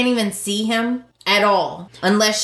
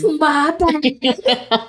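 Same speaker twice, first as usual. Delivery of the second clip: mock Thomas; 5 semitones higher, much slower than usual.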